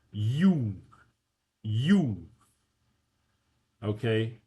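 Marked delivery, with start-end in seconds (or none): 0.1-2.2 "U" said loud and clear as a Russian "yu"; Russian vowel demo. Shown as a demonstration. Tutor models the Russian letter for students. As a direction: sound out "U" for the class. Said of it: "this first one is a soft vowel".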